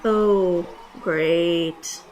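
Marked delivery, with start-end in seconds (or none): none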